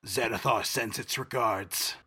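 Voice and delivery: a hint of a low orcish growl; deep orcish voice. Kenku mimics an orc henchman, lightly deep menace. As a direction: deliver the threat coldly, lightly orcish. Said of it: "in a very deep voice ... orcish accent".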